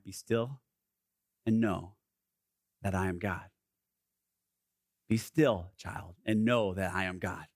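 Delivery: low, hushed and unhurried; calm divine invitation. Acted. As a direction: speak stillness gently, with long pauses. Quotes God's invitation to be still.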